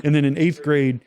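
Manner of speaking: normal talking voice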